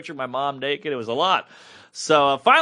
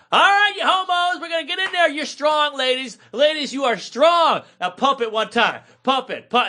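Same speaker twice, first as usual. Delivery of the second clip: spin instructor; 9 semitones higher than usual. Retold or imitated. Spin teacher shouting moves at the class. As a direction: pump up the class like a spin coach. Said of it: "she's like".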